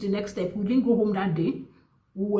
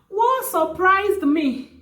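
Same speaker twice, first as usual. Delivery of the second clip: slow, high and louder; high-pitched disbelief; 11 semitones higher, 5 dB louder, slower than usual. Real, not acted. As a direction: stretch it out high in amazement. Resumes the reveal right after laughing.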